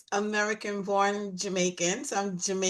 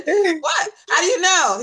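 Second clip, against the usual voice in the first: surprised; 9 semitones higher, 7 dB louder than usual. Retold or imitated. Retold surprise at guy's guess.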